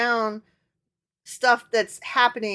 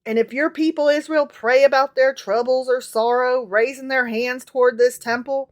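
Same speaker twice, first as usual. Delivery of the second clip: scripture reading; 2 semitones higher, 6 dB louder than usual. Acted. Reads scripture aloud.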